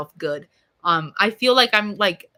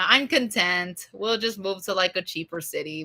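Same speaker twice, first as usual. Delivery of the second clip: ordinary delivery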